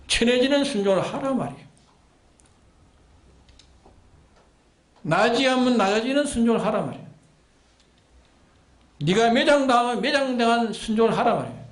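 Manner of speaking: quick, urgent sermon commands; rapid pulpit exhortation; fast preaching push. Real, not acted. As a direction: drive each command home fast.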